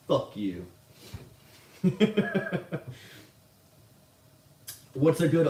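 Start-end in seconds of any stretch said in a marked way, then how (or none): 1.8-3.2 high run of giggles; real gleeful laugh. Real, not acted. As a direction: crack up in high, quick giggles. Laughs after cursing the company.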